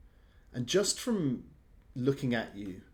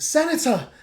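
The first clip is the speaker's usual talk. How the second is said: high, loud shout of relief; guard shouting to the senator in relief; calling out; 11 dB louder than usual. Acted. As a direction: cry his name across the room. Thorn calls out to senator.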